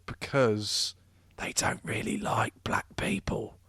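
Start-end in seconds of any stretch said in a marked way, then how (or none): 1.4-3.6 hushed aside, barely voiced; whisper. Real, not acted. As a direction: drop to a whisper for the dig. Whispered aside about the paper.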